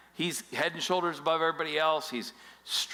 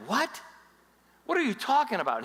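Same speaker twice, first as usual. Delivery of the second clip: put-on high, disbelieving kid's what; young David can't believe his ears; incredulous; 8 semitones higher than usual. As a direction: scoff in disbelief like a cocky kid. David reacts to Goliath news.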